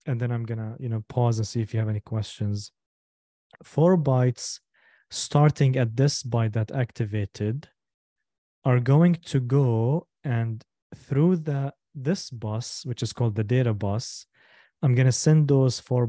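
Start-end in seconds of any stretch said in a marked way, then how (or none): 3.6-12.8 slow, spaced-out explanation with pauses between phrases; deliberately slow. Shown as a demonstration. Teacher walking students through the four bytes, deliberately slow. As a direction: take it slowly, phrase by phrase. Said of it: "I'm gonna say it slow".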